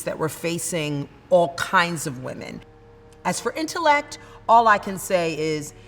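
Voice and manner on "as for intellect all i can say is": higher, rising preacher-like oratory; impassioned oration. Acted. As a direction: win the crowd over with fervour. Recites end of the speech.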